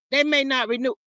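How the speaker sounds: ordinary delivery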